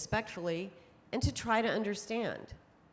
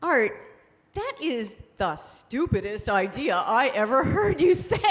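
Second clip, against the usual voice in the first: loud, hammed-up scolding of a husband; mock-supportive spouse; 2 semitones higher, 8 dB louder than usual. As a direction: blast his idea with comic, emphatic disbelief. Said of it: "loving and supportive spouse, said".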